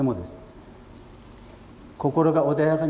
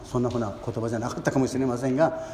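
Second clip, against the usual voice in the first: normal talking voice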